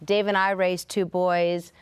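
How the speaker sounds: usual speaking voice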